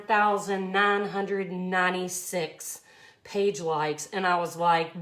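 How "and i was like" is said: normal talking voice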